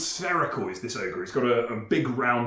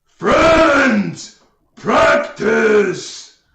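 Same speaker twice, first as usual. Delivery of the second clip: loud, high-pitched ogre bellow; ogre roar; 9 semitones higher, 13 dB louder, much slower than usual. Acted. Game master as ogre charging at player, roaring. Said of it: "he goes".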